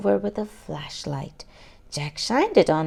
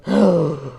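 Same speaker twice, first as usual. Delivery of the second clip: startled gasp; 2 semitones lower, 4 dB louder than usual. Acted. Something brushes her ankle.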